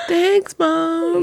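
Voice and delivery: put-on high, drawn little-kid thanks; high-pitched, sweetened kid impression; imitating grateful child. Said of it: "she's so like".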